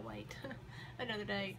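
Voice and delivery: usual speaking voice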